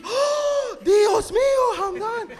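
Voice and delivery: high, loud mock-horrified gasp and exclamation; shocked gasp. Student activities teacher sees the rebel flyer, shocked gasp. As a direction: gasp theatrically, then cry out in scandalised Spanish. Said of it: "she looks at the flyer and she gasps".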